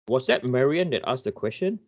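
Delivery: ordinary talk, nothing marked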